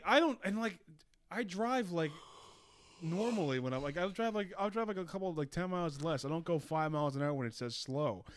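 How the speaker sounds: ordinary talk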